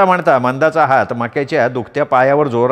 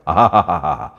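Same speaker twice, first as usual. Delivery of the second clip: low lingering aah of delight; dreamy sigh; 8 semitones lower, much slower than usual. Acted. Makya sighing over the kiss, dreamy aah. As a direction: savour the memory with a long aah.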